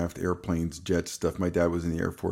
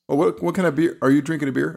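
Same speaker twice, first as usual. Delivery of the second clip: surprised; 8 semitones higher, 5 dB louder than usual. Real, not acted. Surprised at spotting a beer.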